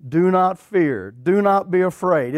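slow, each word weighted; slow emphatic. Acted. Preacher driving home the command to the congregation, slow and weighty. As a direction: lay down NOT, slowly and firmly. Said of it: "emphasis repeated".

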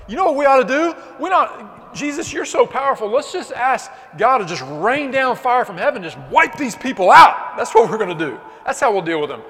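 hammed-up rant, hot and eager to destroy; hot-headed disciples. James and John urging Jesus to rain down fire, hot-headed mock rant. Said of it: "They get so mad".

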